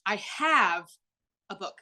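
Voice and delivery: "have" drawn out and leaned on, then a pause; stress on HAVE. Shown as a demonstration. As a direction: lean on the word "have". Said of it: "Those three sentences have the same words".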